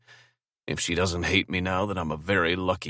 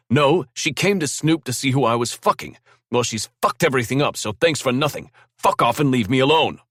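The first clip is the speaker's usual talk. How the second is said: loud, higher furious rant; angry; 8 semitones higher, 7 dB louder than usual. Acted. Drew ranting at his brother Braxton on the phone angrily. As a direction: let the anger boil over.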